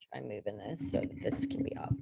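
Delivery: soft whisper off to the side; hushed whisper to someone in the room; whispered aside. Real, not acted. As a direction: whisper it so the stream won't catch it. Off-mic aside to someone nearby.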